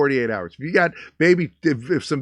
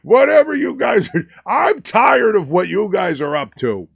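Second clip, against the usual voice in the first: higher, louder fed-up grouch; indignant mock grump, higher and louder; mock grumpy guy; 8 semitones higher, 8 dB louder than usual. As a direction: bark it like an annoyed old-timer. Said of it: "he's like".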